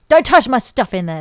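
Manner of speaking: yelling. Acted. Kid shouting toward the treehouse, loud demand. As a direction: call it out possessively.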